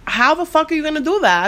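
heated confrontation. Retold or imitated. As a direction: fire the question back in heated disbelief. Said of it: "get more heated".

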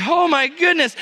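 high, shocked oh my goodness; startled gasp. Retold or imitated. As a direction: caught off guard, gasping. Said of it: "and I'm like".

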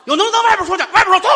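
又高又响的尖叫质问; 挨了一巴掌，冲媳妇尖声喊; 尖声叫嚷. Acted. 夫妻吵架，挑衅出门. (挨打的丈夫冲媳妇尖声嚷嚷)

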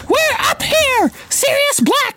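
shrill high scream for help. A narrator's reading. Hermione screams for help to those downstairs. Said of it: "Hermione screamed suddenly".

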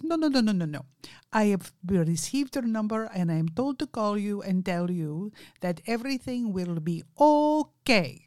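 imitated Indian accent, opening on a run of noes. Caller told to say everything will be okay, Indian accent. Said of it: "he has a very strong Indian accent".

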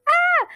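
high, loud retold squeal; sudden high "ah!" of mock overexcitement; excited squeal. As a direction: let out a giddy little shriek, imitating yourself. Excited reaction to word boyfriend.

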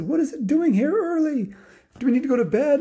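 high, puzzled alarm; startled onlookers. Acted. People spot Santa's sleigh early, startled.

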